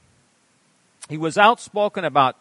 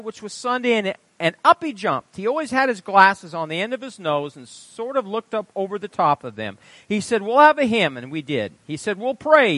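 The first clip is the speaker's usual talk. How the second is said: animated sermon reading; 7 semitones higher, 7 dB louder than usual. Acted. Preacher reading a testimony aloud.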